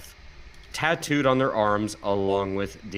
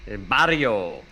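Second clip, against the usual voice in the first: El barrio with trilled r and Spanish vowels. Shown as a demonstration. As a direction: roll the r in El barrio.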